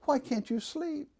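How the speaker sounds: high, soft, sweet imitation of a mother; sweetest motherly voice. Mother comforting her crying child at bedtime, very sweetly. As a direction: coo gently like a doting mom. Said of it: "in her sweetest voice".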